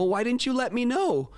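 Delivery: high-pitched retold protest; exasperated question. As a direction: push the question up, mildly incredulous. Retold exchange with upset student.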